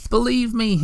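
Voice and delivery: high, pleading appeal; imploring. A narrator's reading. Moomintroll implores the Hemulan to believe him, high pleading. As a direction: beg him earnestly. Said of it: "implored Moomintroll".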